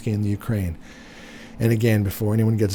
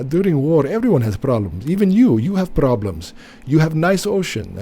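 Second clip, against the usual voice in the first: mock Zelensky accent; 6 semitones higher, 5 dB louder than usual. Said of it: "he was saying".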